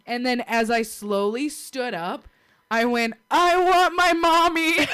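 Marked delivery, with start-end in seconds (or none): 3.3-4.9 high, loud, drawn-out whine for mommy; comic cry of pain, stretched out; hammed-up wail. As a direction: wail it like a hurt little kid. Acted wail for mom in pain.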